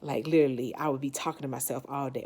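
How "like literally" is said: ordinary delivery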